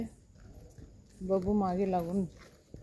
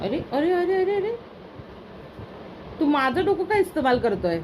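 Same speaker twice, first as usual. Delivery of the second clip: startled exclaiming; 8 semitones higher than usual. Real, not acted. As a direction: react as dog climbs on you. Dog climbing on her head. (घाबरून ओरडणे)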